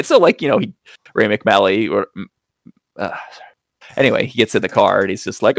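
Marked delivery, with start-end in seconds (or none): none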